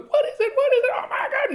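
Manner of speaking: imitating a panicked kid in very high falsetto; mock high-pitched kid wailing; little kid. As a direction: squeal it like a frightened child. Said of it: "this little kid going".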